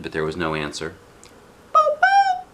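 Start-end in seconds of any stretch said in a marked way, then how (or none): 1.7-2.4 very high, loud questioning call. Acted. Shang calls to the fallen wolf, high and loud. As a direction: call down to check if he's alive. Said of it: "Piazza shouted".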